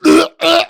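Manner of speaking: loud, high gagging noises; mock retching. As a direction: imitate coughing up a swallowed object. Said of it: "He, like, regurgitated".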